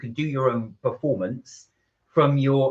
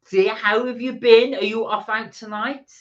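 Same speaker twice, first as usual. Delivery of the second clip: high greeting; 8 semitones higher, 3 dB louder than usual. Acted. Greeting viewer by name.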